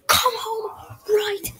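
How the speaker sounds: high, slow, crying yell; angry crying shout. Acted. Mother on the phone ordering her kids home, crying shout. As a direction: scream the order through tears.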